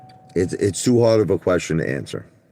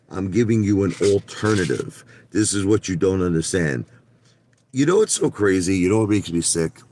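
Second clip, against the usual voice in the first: ordinary talk, nothing marked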